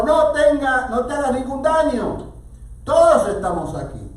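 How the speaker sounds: loud, high cry of warning; urgent shout. A narrator's reading. Paul stopping the jailer from harming himself, urgent shout. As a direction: call out urgently to stop someone. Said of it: "Pero Pablo le gritó".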